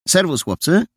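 bright hello called across; calling out. Acted. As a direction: greet the boys from a distance. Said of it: "zawołała".

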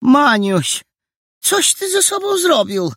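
high, loud shocked question; horrified auntie; landlady alarmed at Maniuś's face. Acted.

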